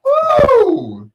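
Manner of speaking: high, loud excited whoop. Real, not acted. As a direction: let out a big hype whoop. DJ whooping over the music.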